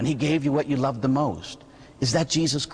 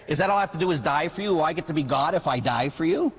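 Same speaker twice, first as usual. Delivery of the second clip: normal talking voice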